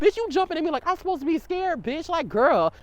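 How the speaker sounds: loud, high indignant rant; loud high indignant outburst. Retold or imitated. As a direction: fire it back loud. Angry retelling of confrontation.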